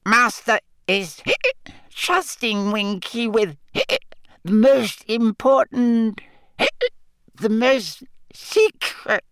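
very high, slow, hiccup-broken squeak; a tipsy little elf slurring between hiccups; drunk hiccuping elf. A narrator's reading. Winky answers Harry, drunk and hiccuping, in a very high squeak.

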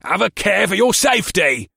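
high, loud commanding call; stern warning shout. A narrator's reading. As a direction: warn her sharply, loudly. Orc captain warning sister.

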